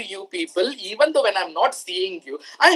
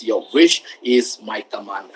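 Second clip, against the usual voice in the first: deep, slow genie voice; 11 semitones lower than usual. Retold or imitated. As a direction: draw it out low like a genie. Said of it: "how genie says".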